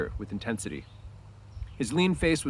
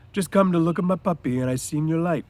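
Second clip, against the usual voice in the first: higher, louder, eager explaining; Lenny explaining; 7 semitones higher, 6 dB louder than usual. A narrator's reading. Lenny explaining to Crooks why he came. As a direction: plead innocently like a big child. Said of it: "he explained".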